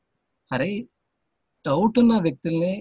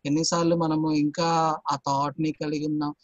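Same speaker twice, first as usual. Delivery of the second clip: normal talking voice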